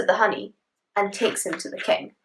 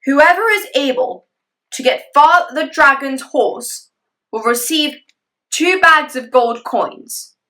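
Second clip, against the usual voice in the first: royal proclamation; 5 semitones higher, 6 dB louder, slower than usual. Acted. King's proclamation.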